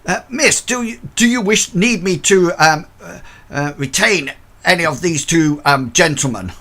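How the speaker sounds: nervous porter asking the miss for orders; flustered old porter. Acted. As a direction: fumble out an eager offer to the lady. Porter Bert offers to detain men.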